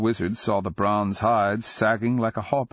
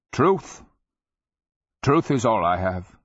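put-on old wizard voice; 4 semitones higher, 4 dB louder than usual. A narrator's reading.

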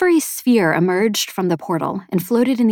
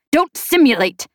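higher, insistent order; urgent command; 7 semitones higher, 4 dB louder than usual. A narrator's reading. Man cuts off the sphere's answer, urgent command. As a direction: cut in forcefully.

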